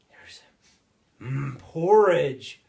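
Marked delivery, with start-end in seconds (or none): 1.2-2.6 loud, drawn-out relish; hearty, high, lingering delight; savoring. A narrator's reading. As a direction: savor the food word by word, slow and big. Relishing porridge while telling tale.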